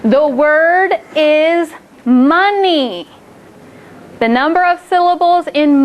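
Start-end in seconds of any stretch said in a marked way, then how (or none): 0.0-3.1 slow teacher voice. Shown as a demonstration. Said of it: "teacher says word slowly".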